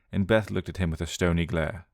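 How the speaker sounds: ordinary delivery, nothing marked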